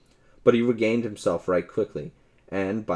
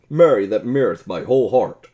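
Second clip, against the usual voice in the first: cried out; 3 semitones higher, 8 dB louder than usual. A narrator's reading. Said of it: "cried the stranger".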